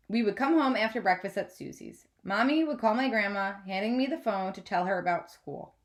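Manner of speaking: ordinary delivery, nothing marked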